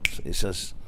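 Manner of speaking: ordinary delivery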